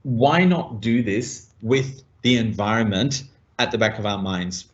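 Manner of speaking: ordinary talk, nothing marked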